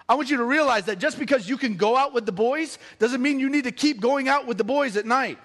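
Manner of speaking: normal talking voice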